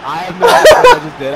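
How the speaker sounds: words squeaked out through laughter; real laughing confession. Real, not acted. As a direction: giggle helplessly at the lucky goal. Laughing at own lucky goal.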